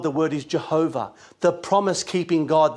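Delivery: ordinary delivery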